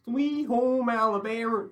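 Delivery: high, slow country twang; mock country accent. Shown as a demonstration. Friend answering a request for an accent, hammed-up country twang. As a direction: drawl it out in a jokey country accent. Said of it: "like a country accent?".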